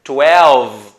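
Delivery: twelve drawn out, low and loud, closing on v; "twelve" held down to its v; ending on V. Shown as a demonstration. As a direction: say "twelve" with no final e. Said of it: "o som termina no V".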